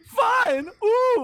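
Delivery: high squeal. Real, not acted. Giving in, doing the accent.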